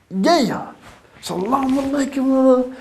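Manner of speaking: high, beaming salaam; high, glowing excited salaam; hyped greeting. Retold or imitated. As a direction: greet your brother bursting with joy.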